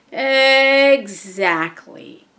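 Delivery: very slow, stretched-out word; drawn-out exasperation. Real, not acted. Mom reacting to son's excuse, slow and exasperated. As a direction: drag it out to hammer the point.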